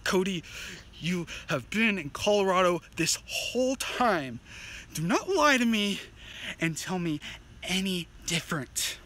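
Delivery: low, slow, deliberate warning; grave insistence. Acted. Warning a friend not to lie, slow and grave. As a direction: weigh every phrase with pauses between.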